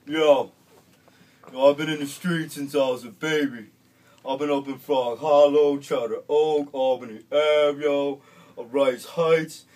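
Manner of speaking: loud, slow street swagger voice; street tough kid. Retold or imitated. Kid boasting to the recovery group, loud street swagger. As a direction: preach your street cred to the group.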